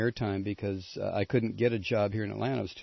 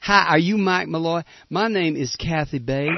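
higher-pitched mock Southern accent; higher, put-on Southern belle accent; 6 semitones higher, 3 dB louder than usual. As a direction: imitate her sweet Southern introduction. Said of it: "she put on that southern accent".

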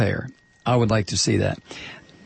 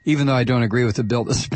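normal talking voice

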